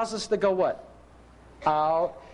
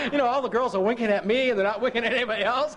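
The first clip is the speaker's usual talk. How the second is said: talking through real laughter, higher; laughing; 4 semitones higher, faster than usual. Real, not acted. Preacher laughing at prostitutes winking at him. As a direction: giggle through the words, fast at the end. Laughing at his own story.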